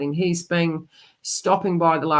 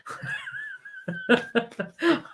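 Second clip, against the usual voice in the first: high laugh. Real, not acted. Reader laughing off her own pill joke, rising high giggle. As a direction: break into a bright, lifting giggle. Laughing, disclaiming the Valium remark.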